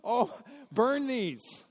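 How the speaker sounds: high, loud mock horror; horrified. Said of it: "you're like".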